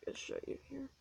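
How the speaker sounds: soft unvoiced aside; whispering. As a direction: whisper it under your breath. Aside while showing the book.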